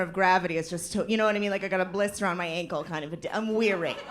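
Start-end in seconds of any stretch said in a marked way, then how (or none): none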